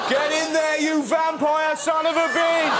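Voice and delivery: high, loud laughing cheer, drawn out slow; excited cheering. Acted. Host cheering on Robert Pattinson, laughing shout. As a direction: whoop him on like a mad fan.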